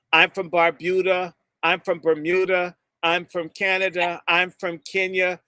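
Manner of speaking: usual speaking voice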